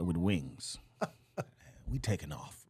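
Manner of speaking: very low, soft deadpan; imitating laid-back Ali. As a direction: let it out deep and quiet, in no rush. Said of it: "he'll just be like".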